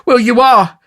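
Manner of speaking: put-on high, loud childish comeback; high-pitched snivelling accusation; whining retort. A narrator's reading. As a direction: insist petulantly in a high voice. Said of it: "retorted Noah".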